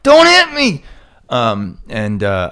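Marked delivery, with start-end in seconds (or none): 0.0-0.8 very high, loud squealed plea; hammed-up frightened falsetto shriek; mock terror. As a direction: imitate someone cowering from a punch. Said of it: "They're like".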